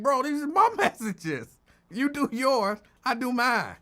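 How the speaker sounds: loud, high retold protest with a laugh in it; mock protest. Host retelling how she told Lincoln off, mock protest. Acting out own protest to him.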